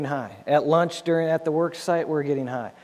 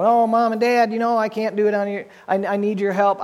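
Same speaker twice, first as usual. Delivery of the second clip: higher, louder, rushed mock begging to Mom and Dad; whiny plea to parents; 7 semitones higher, 5 dB louder than usual. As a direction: play the helpless kid asking parents for rescue. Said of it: "I cannot go home and go".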